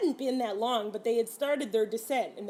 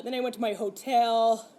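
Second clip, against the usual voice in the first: ordinary talk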